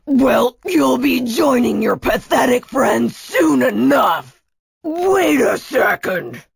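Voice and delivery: put-on villain voice, turning suspicious; bossy villain, taunting. New leader taunts silent Tia, gloating then suspicious.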